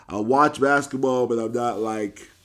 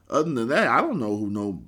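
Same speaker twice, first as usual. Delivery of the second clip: usual speaking voice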